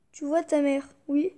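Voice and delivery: usual speaking voice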